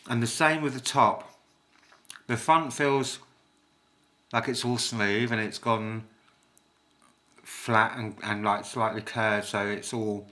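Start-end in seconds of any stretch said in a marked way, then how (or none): none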